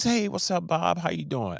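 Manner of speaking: high, cheery hey to a star; friendly greeting. Retold or imitated. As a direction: greet him like an old pal. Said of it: "So I says".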